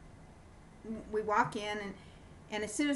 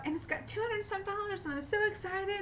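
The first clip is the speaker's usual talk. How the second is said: excited report; 11 semitones higher than usual. Retold or imitated. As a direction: gush about the money in her friend's voice. Friend's excitement, retold.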